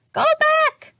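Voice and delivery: very high, loud parrot voice; squawking parrot. A narrator's reading. Polly the parrot warning the children, high squawk. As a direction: screech it like a parrot. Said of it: "squawked Polly".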